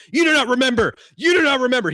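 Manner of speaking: high, loud ranting shout; yelling God. Retold or imitated. God yelling at Bob in the astral, loud high shout. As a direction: bellow it down at him like an angry deity. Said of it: "He's yelling at him".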